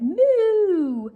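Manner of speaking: high, loud moo; duck mooing. Acted. Duck pretending to be the cow, a high loud moo.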